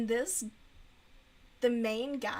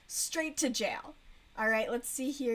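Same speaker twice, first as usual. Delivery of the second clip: usual speaking voice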